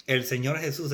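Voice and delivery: usual speaking voice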